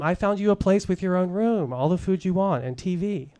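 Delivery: high, sing-song sales pitch; put-on perky caseworker voice; chirpy salesman. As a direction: sell it with chirpy institutional cheer. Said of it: "the chirpy salesman of institutional care".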